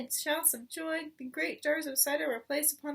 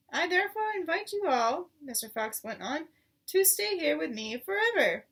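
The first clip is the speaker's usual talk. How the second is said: rousing invitation; 4 semitones higher, 6 dB louder than usual. A narrator's reading. Fox's rousing speech.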